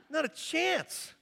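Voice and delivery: slow, high "Not a chance"; emphatic no. Real, not acted. Pastor refusing the late guest, slow and emphatic. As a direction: refuse it with mock disbelief.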